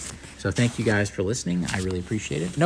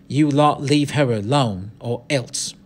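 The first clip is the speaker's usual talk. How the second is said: warning threat; 4 semitones higher, 5 dB louder, slower than usual. Acted. Boy threatens the gang.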